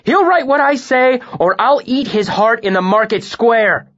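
high, loud, savage threat; raging menace at the scribe; furious threat. A narrator's reading. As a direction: spit out the threat.

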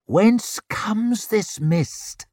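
high, slow, drawn-out hiss; hissed question. A narrator's reading. Warrior creeping forward into mist, hissing a question. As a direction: hiss it out slowly, pitched high. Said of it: "hissed Stand of the Barrows".

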